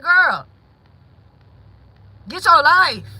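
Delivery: mock sassy put-down; sassy retort. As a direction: brush her off. Retold retort to sister.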